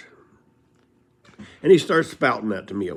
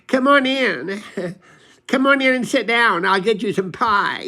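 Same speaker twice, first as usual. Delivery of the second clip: high-pitched, louder mock old woman; Millie's voice; 10 semitones higher, 6 dB louder than usual. Millie McCurdy greeting a visitor, high mock voice. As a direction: pitch way up for a chatty hostess. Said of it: "and she goes".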